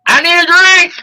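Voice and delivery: high, pleading little cry for a drink; mock Lacey. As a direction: whine it like she's desperate for a drink. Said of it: "And Lacey be like".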